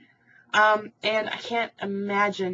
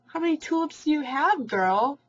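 higher, excited disbelief; amazed; 8 semitones higher, 5 dB louder than usual. Real, not acted. Vlogger asks a viewer about her tulips, amazed and excited. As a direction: gush over the huge tulip count.

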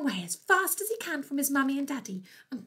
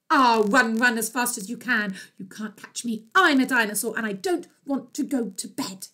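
loud running taunt, punched out; defiant little dinosaur; 7 dB louder than usual. Acted. Dinosaur running past the vampire, loud defiant taunt. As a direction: shout your refusal of bedtime.

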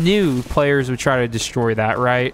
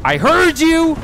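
high, loud call-out; triumphant shout; 5 dB louder, slower than usual. Real, not acted. Player catching an enemy sneaking up, high loud shout. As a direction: holler it at the sneak.

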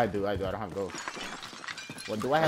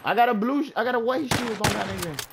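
panicking gamer; 10 semitones higher, 4 dB louder than usual. Real, not acted. Frantic callout after shooting enemy.

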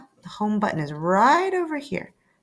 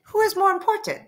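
much higher, quick reply voice; software character; 9 semitones higher than usual. Acted. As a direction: software setting the kid a question.

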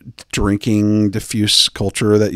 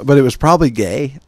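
usual speaking voice

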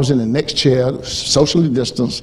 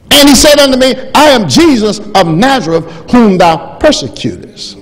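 preaching at full force; 9 semitones higher, 7 dB louder than usual. Acted. Preacher reading Acts dramatically.

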